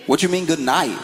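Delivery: high, puzzled friend; baffled friend voice. Retold or imitated. Friend on the phone, high and puzzled.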